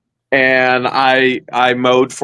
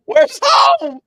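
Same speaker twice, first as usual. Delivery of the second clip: an even higher, thin mock-panicked wail; mock lost wail. Still lost in tall backyard grass, a very high hammed-up wail. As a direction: repeat the call higher still, hamming up being lost.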